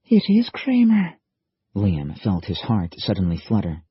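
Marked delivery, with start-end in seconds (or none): 0.0-1.2 much higher, louder gasp of realization; shocked recognition. Acted. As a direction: it hits him all at once. Liam recognizes the enemy.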